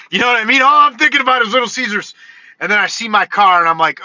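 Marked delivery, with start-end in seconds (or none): none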